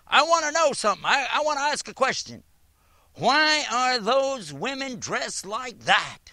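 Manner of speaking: high, loud mocking imitation of a demanding woman; hammed-up imitation of an angry questioner; harsh woman's challenge.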